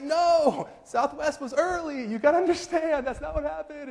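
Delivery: very high, hammed-up pleading; defensive protest. Husband defending himself to his wife, high mock protest.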